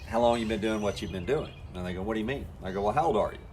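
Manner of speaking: normal talking voice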